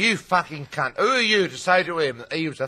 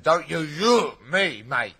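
mock menace; 3 semitones higher than usual. Threatening quote in story.

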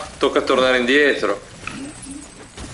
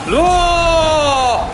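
high desperate yell of no; sudden panicked cry; shout; 12 semitones higher than usual. Real, not acted. Disaster in game.

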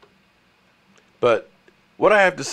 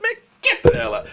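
exasperated squeal; 3 dB louder than usual. Real, not acted. Exasperated at rival fans.

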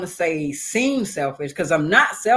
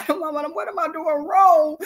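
high-pitched plaintive wail, acted out; mock crying; 11 semitones higher than usual. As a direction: plead with Mama as if crying. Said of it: "I'm crying like".

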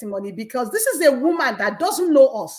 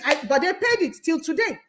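normal talking voice

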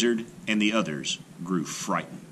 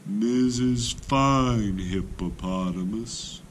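flattering call; 3 semitones higher, much slower than usual. A narrator's reading. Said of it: "Clever Tortoise said".

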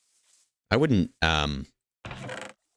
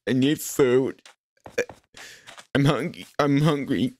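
hungry complaint; 7 semitones higher than usual. Pretend. Player complains of hunger in game.

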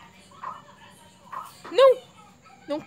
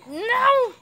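frustrated cry; 12 semitones higher, 6 dB louder than usual. Real, not acted. Loses the game, cries out.

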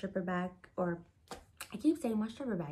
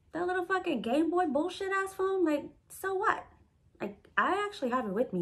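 high-pitched, scornful comeback; indignant retort; 10 semitones higher than usual. Retold or imitated. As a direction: fire back dismissively, pitch jumping way up. Indignant retort in retold quarrel.

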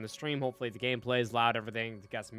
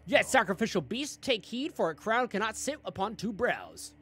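raised, booming prophetic reading; high solemn cult proclamation; dramatic game narration; 9 semitones higher, 5 dB louder than usual. Acted. Reads game narration aloud.

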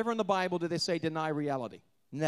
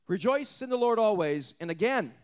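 loud, raised preacher's proclamation; preaching rejoice; 3 semitones higher, 5 dB louder than usual. Acted. Preacher proclaiming Paul's command to the church.

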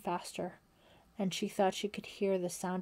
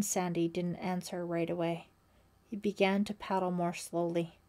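ordinary delivery

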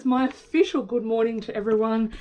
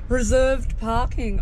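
usual speaking voice

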